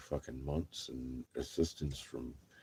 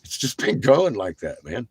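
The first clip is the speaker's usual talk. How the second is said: normal talking voice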